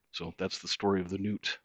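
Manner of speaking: ordinary talk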